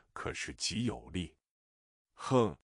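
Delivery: ordinary delivery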